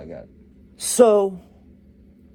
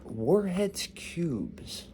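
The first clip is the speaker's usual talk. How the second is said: deadpan doctor; 7 semitones higher, 5 dB quieter, much slower than usual. Acted. Doctor's deadpan punchline reveal.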